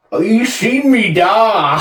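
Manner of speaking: high, loud, slow Northern Irish; hammed-up Belfast accent; exaggerated Northern Irish, stretched out. Shown as a demonstration. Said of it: "do Northern Irish".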